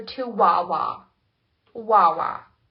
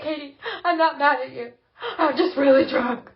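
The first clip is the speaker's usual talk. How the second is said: mock sobbing; 5 semitones higher, 8 dB louder than usual. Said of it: "Claire's sobbing".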